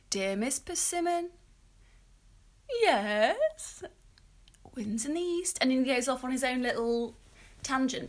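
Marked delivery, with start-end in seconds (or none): 0.1-5.6 lightly voiced Bert, higher and slow; a hint of Bert's sing-song greeting; imitating Bert. Retelling Bert greeting Miss Persimmon, lightly imitated. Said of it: "'Cause he".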